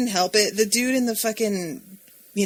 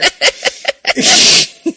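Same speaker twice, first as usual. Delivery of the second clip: loud high burst of laughter; 12 dB louder than usual. Real, not acted. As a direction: crack up out loud.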